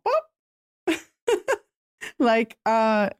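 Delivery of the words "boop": short high-pitched boop sound; a tiny high blip for a wall popping up. Retold or imitated. Freelancer mimics her wall going up at a client's email, high boop.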